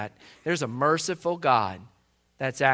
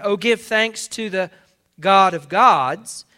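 loud, raised scripture proclamation; exclaimed praise; 4 semitones higher, 9 dB louder, slower than usual. Real, not acted. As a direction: proclaim it with an exclamation point, just short of shouting. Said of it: "we should be shouting".

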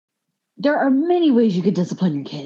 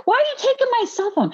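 much higher and fast, incredulous; outraged disbelief; much faster than usual. Retold or imitated. Teen protests as teacher takes her phone, outraged. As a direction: squeal it in outrage.

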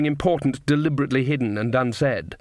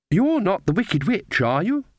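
put-on high, slow child voice; little girl; 7 semitones higher, slower than usual. A narrator's reading. Girl quizzes the strange women, childishly suspicious. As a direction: question them as a suspicious small child.

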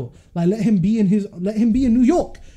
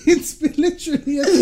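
put-on laughing New York accent, higher; 8 semitones higher than usual. Friend mocked for New York accent while ranting. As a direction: hams up a New York accent mid-rant. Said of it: "Do you have to do the accent?".

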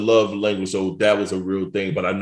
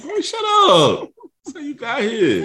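retold scolding; 3 dB louder than usual. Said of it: "I'm like".

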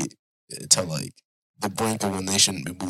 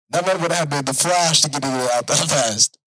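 usual speaking voice

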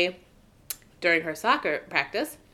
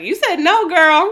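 put-on high, loud sassy girlfriend quote; sassy retort; 11 semitones higher, 8 dB louder, slower than usual. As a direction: call her out with playful sass. Acting out friend's sassy retort.

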